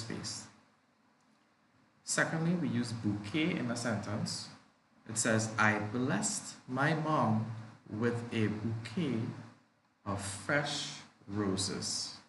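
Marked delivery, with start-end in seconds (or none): 5.6-12.2 slow model reading. Shown as a demonstration.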